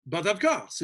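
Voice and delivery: normal talking voice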